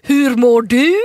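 high, loud talking lion voice. Retold or imitated. A tame lion greets safari tourists in a silly high voice. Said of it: "kan lejonet säga".

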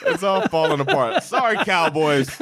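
high voice, cracking up with laughter; talking through real laughter; real laughing dismay. Real, not acted. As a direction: laugh helplessly as your picks collapse. Picks collapse, cracking up while apologizing.

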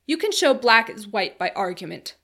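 loud, forceful rebuttal; argumentative protest. A narrator's reading. Philby disputing the time traveler's argument, protesting loudly. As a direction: push back hard and loudly. Philby argues against the claim.

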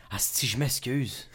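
low, soft, slow retreat; hushed, drawn-out mock contrition; sheepish apology. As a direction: back off quietly, imitating an embarrassed apology. Imitates the producer apologizing.

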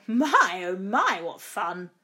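loud start, easing off by the end; dentist's exclamation of delight; gleeful yell. A narrator's reading. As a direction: yell it out with relish.

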